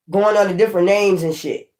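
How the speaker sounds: normal talking voice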